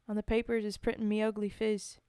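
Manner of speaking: put-on Cockney storytelling voice; homely Cockney verse monologue; Cockney accent. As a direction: recite it like an amateur Cockney. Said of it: "Cockney British one".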